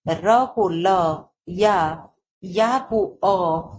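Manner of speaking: slow dictation. Shown as a demonstration. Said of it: "उदाहरण के लिए".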